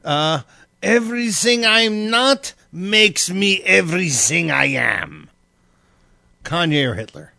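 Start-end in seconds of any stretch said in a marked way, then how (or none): 0.8-5.3 put-on slow, high German accent. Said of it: "all in a German voice".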